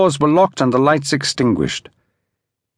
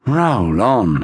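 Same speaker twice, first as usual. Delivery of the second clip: weary mutter; 4 semitones lower than usual. A narrator's reading. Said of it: "he muttered wearily".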